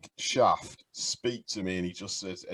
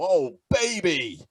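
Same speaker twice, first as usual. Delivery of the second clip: imitated commentator's cry, high and loud; gleeful mock commentary, slow and raised; excited catchphrase; 7 semitones higher, 5 dB louder, slower than usual. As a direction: stretch out the catchphrase like a big play call. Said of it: "all I can do is go".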